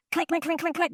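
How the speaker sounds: high, rapid vocal clinks; imitated metal banging. He bangs metal on her door, imitated quick clinks. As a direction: mimic metal striking a metal door. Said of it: "He has something metal in his hand".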